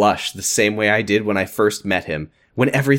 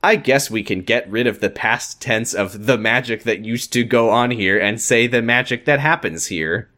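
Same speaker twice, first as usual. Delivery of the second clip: ordinary delivery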